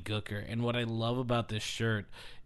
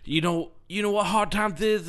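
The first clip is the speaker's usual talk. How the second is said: Dusty Rhodes impression; 7 semitones higher, 3 dB louder than usual. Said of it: "he goes".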